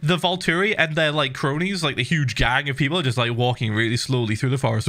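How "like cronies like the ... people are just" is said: ordinary talk, nothing marked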